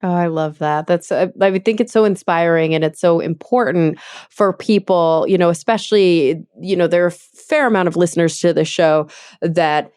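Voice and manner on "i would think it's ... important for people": usual speaking voice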